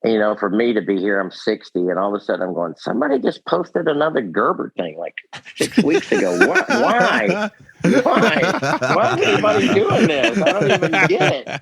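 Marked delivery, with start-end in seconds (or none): 2.8-11.5 high, loud, laughing disbelief; baffled disbelief. Retold or imitated. To the hosts about fans still posting, laughing high disbelief. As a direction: squeal the why like you cannot believe it. Baffled by fans' new Gerber posts.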